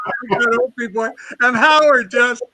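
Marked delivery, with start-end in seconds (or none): 0.1-1.1 mock Robin Williams, faster.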